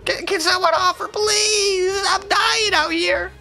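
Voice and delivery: high whiny "please" drawn out; mock desperate plea. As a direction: beg the server in a hammed-up whine. Pleading for offers.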